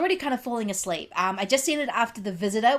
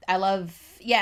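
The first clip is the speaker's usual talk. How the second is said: normal talking voice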